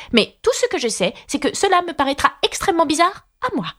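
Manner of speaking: high-pitched, earnest Alice; Alice, insistent. Acted. (Alice insistante, voix très aiguë)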